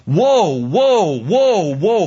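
loud, pounding prophet's woes; mock biblical prophet. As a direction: hammer each woe like a prophet. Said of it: "the proclamation of the prophet Isaiah".

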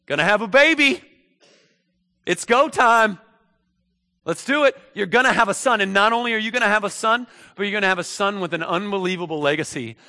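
high, loud, eager heralding; excited announcement. Acted. Gabriel tells an elderly man he will have a son, excited announcement. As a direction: announce big news at full volume.

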